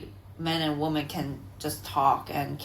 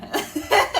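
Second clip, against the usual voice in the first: high laugh; 6 dB louder than usual. Real, not acted. Laugh after joke.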